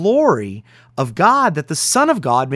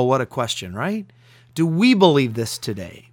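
ordinary delivery

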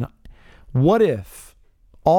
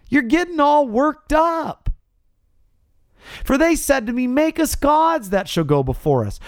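whiny excuse-making Aaron; 10 semitones higher than usual. Retold or imitated. Aaron makes excuses to Moses.

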